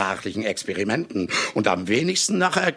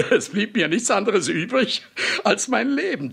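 higher laugh spilling into the words; amused laugh; 10 semitones higher than usual. Acted. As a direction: laugh at his own failure, then speak on. Laughs at his failure. (amüsiertes Lachen, ins Reden hinein)

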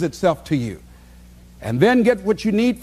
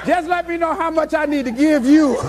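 mock hurried customer; 8 semitones higher than usual. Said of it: "they say".

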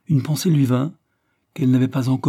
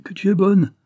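high, loud, rising burst of gratitude; tears in the voice, cry it out; overcome cry; 10 semitones higher, 5 dB louder than usual. A narrator's reading. Husband, moved to tears, cries out his thanks to his wife. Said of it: "s'écria-t-il".

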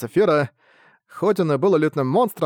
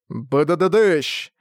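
explosion noise. Acted. Explosion sound effect.

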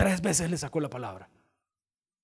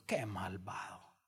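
susurrado, entre dientes; susurro; 9 semitones lower, 14 dB quieter, much slower than usual. Real, not acted. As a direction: susurra con fingido escándalo. (el predicador comenta susurrando)

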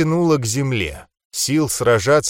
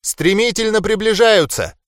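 alarmed shout; 9 semitones higher than usual. A narrator's reading. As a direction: cry out the danger. Gnome warning of incoming enemies.

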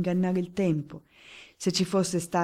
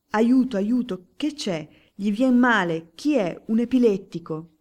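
cries for help; 4 semitones higher, 7 dB louder than usual. Acted. Crowd crying out for help.

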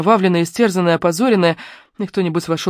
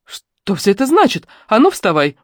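higher, louder, slow shocked demand; shocked outcry; 5 semitones higher, 4 dB louder than usual. A narrator's reading. Woman finds the girl on the floor, shocked and stern. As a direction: stop the scene cold with outrage.

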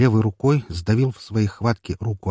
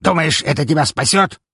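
menacing shout; 8 semitones higher, 5 dB louder than usual. Acted. Boss threatens the hero.

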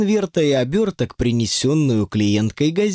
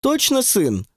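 higher, lightly demanding question; 9 semitones higher than usual. A narrator's reading. Client presses the fortune-teller for an answer, demanding. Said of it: "снова заговорила требовательнее".

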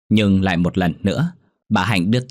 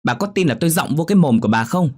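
angry scolding daughter; 10 semitones higher than usual. Acted. Daughter berating her old mother over porridge, high and angry.

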